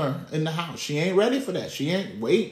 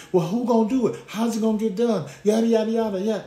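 higher-pitched imitation of excuse-makers; mock fretting; 6 semitones higher than usual. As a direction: mimic someone fussing over chores. Mimics excuses for not resting.

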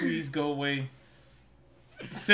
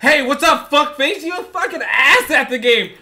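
louder, higher mock insult; mock rude greeting; 7 semitones higher, 8 dB louder than usual. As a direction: bark the greeting out of nowhere. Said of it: "out of nowhere".

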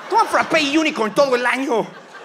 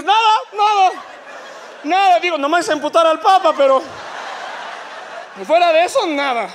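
flustered woman; 8 semitones higher than usual. Pretend. Woman answering husband, upset.

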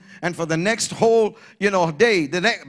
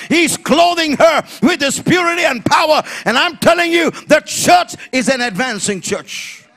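rising shouted preaching, higher; preaching at full force; 5 semitones higher than usual. Acted. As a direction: build the sermon to a shout. Preaching climax.

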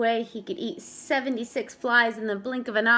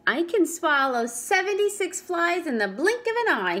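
boastful fib; 7 semitones higher than usual. Acted. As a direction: show off with a fib. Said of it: "So he fibbed".

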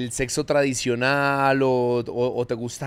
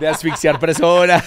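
loud words through laughter; laughing out loud; laughing hard; 3 semitones higher, 8 dB louder than usual. Real, not acted. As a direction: blurt it out while cracking up. Both crack up at strangling joke.

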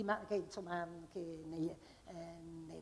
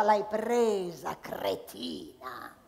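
gruff butterfly; 4 semitones lower, much slower than usual. Acted. Butterfly mocking Teresa in a low sneer. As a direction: growl the insult down low.

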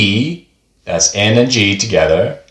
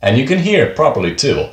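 ordinary talk, nothing marked

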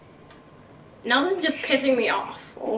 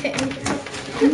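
ordinary talk

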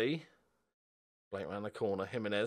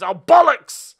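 loud, high furious curse; real frustrated yell; angry outburst; 20 dB louder, slower than usual. Real, not acted. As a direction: blurt it out in dismay. Team concedes a goal.

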